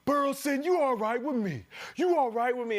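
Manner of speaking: loud, high challenge; confronting the heckler. Retold or imitated. Player confronting the heckler after scoring: loud, high challenge. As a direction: call him out, loud and pointed.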